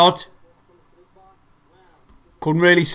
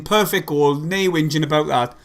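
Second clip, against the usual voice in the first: usual speaking voice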